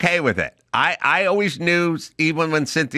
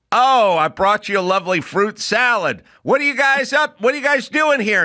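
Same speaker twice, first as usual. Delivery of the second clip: hammed-up improv entrance; 8 semitones higher than usual. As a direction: burst in overplaying the scene. Student performing improv entrance.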